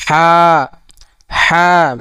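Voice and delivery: long হা pushed from the middle of the throat. Shown as a demonstration. Teacher repeats Arabic হা from mid-throat, pressed and long. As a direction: squeeze the h of হা and hold the vowel. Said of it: "মধ্যখান হইতে".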